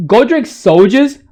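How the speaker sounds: ordinary talk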